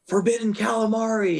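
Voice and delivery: high, silly put-on voice; playful imitation, voice pushed way up; teasing mock voice. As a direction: announce it as a goofy high-pitched joke. Tattooist's joke acted out.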